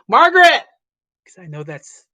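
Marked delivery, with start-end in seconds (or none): 0.1-0.6 loud call, much higher. Acted. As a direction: call his wife across the house. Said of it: "he would call out to the female".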